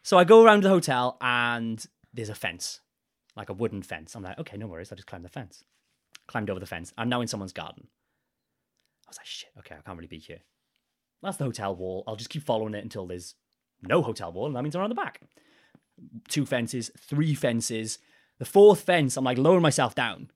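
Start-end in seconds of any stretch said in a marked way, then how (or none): 4.4-15.2 sneaking thoughts. Retold or imitated. Sneaking through someone's garden.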